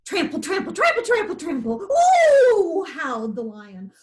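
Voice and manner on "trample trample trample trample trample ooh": high rhythmic trample chant; trampling noises then howl. A narrator's reading. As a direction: stamp out the running hooves. Peccaries trampling, lion howls.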